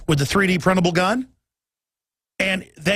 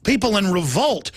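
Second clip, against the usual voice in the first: normal talking voice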